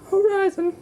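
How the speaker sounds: very high squeak; squeaky high. Acted. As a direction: pop it up in a squeaky voice. Repeating hop name in voices.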